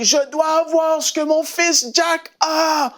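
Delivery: high, slow, pleading declaration; mother at the altar cries out, fervent; fervent high cry. Retold or imitated. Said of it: "elle crie".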